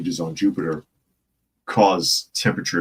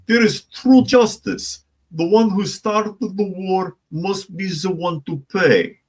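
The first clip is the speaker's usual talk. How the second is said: mock Zelensky voice; 11 semitones higher, 3 dB louder, slower than usual. Mock-reading Zelensky's statement.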